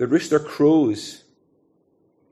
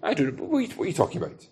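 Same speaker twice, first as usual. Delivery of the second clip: nervous chuckle through a hurried denial; laughing it off; 5 dB quieter, much faster than usual. Acted. Peter fobbing off the servant girl, laughing it off. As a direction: play at brushing it off with a light laugh, tailing off softer. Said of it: "he's trying to laugh it off".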